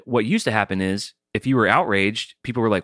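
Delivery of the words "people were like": normal talking voice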